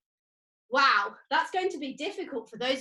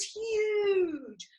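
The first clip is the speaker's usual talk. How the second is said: "huge" high and long drawn out; emphatic huge; 6 semitones higher than usual. Real, not acted. Teacher telling children about the rocket, "huge" drawn out. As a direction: stretch "huge" out to show the size.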